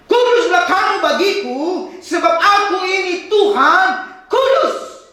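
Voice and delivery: slow emphatic reading. Acted. Preacher rereading the verse to the congregation, slowly and emphatically. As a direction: stretch out each word of God's claim.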